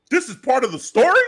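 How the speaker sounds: high, loud incredulous question; shocked disbelief. Retold or imitated. Fan shocked the mask is back, incredulous. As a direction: blurt it out in disbelief.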